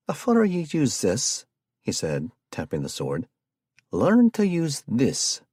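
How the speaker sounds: lightly done bad Scottish accent; hammed-up fake Scottish accent; terrible Scottish accent. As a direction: mangle it in a poor Scottish accent. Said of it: "in a terrible Scottish accent".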